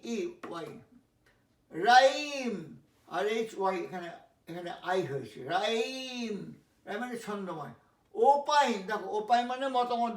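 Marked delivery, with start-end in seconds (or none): 1.7-2.8 slow demo. Shown as a demonstration. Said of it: "slow teaching demo". 5.4-6.5 slow demo. Shown as a demonstration. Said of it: "slow teaching demo".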